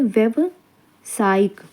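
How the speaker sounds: व्यावसायिक very slowly, a gap before सायिक; slow, broken up. Shown as a demonstration. Teacher asks for close attention, slow and broken up. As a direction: split व्यावसायिक into two careful parts. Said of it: "इसको ध्यान से देखिए".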